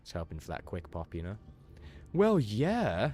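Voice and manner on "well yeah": loud, high imitation of an obvious yes; hammed-up "of course"; mock exasperated reply. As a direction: voice a friend's exaggerated reply. Voices a friend's typed reply.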